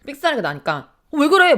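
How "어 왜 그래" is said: sudden high, loud startled cry; startled husband. Retold or imitated. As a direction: mimic him jumping in fright. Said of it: "막 이러면서 막 본인이 막, 막 놀래가지고".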